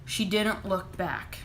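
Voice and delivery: ordinary delivery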